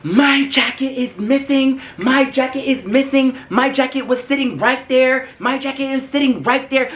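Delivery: high-pitched, insistent mock freak-out; frantic accuser. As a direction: imitate her repeating it in a high, panicked whine. Acted frantic woman causing a scene.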